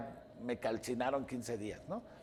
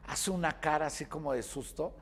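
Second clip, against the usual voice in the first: usual speaking voice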